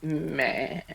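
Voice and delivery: bleating meh like a goat; playful goat bleat; goat impression. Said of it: "It wasn't a bad goat impression".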